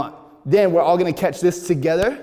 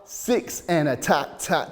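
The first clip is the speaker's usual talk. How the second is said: usual speaking voice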